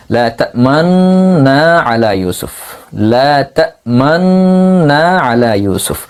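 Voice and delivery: লা তা'মান্না said slowly, lips rounding after তা'মান; slow, careful লা তা'মান্না with a rounded-lip hint of the dhamma; ishmam demo. Shown as a demonstration. Teacher showing the ishmam, slow careful লা তা'মান্না. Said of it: "লা তা'মান বলার পর আপনি পেশের দিকে ইশারা করবেন".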